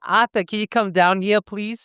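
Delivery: very high Boston mom call, squawky; mock the duck-voiced Boston mom. Host copies cohost's Boston mom, high and squawky. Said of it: "You sound like a duck".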